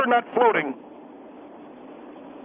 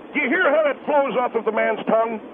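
usual speaking voice

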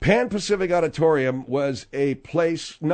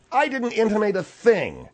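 higher-pitched, exasperated protest; indignant denial; 7 semitones higher, 3 dB louder than usual. Acted. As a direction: protest your innocence, pitch up. Denies the accusation in quarrel.

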